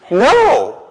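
loud, high-pitched no; forceful pulpit rejection; emphatic refusal. Real, not acted. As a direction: burst out with an outraged no. Answers own absurd rhetorical question.

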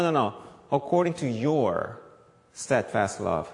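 ordinary talk, nothing marked

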